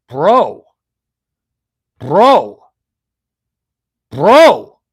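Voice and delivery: loud, rising stunned bro; awed bro. Retold or imitated. Dudes at the urinals shouting in awe. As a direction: call out bro in loud disbelief. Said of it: "dudes are like".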